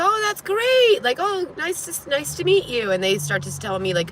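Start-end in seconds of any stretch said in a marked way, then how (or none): 0.0-2.9 high, slow, gushing politeness; overly sweet reply. Retold or imitated. Telling viewers how she gushed at the couple, high and slow. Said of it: "I go".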